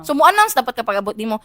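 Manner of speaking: normal talking voice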